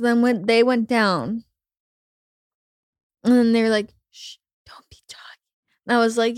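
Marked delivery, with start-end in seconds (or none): 4.1-5.4 hushed whisper. Retold or imitated. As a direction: whisper it, shushing. Retold shushing girls.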